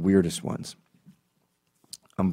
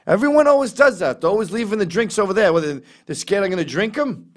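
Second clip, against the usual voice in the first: much higher and louder, fast complaint; mock indignant cashier; 11 semitones higher, 6 dB louder than usual. Cashier griping at the comic, much higher and louder. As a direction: complain as if accused of theft.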